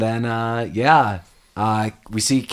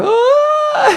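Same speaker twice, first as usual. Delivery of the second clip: very high imitated horror-film scream; Daniel Stern scream; 4 dB louder than usual. Podcaster mimicking a movie burglar's scream, high shriek. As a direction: shriek like a man swarmed by pigeons. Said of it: "the Daniel Stern scream".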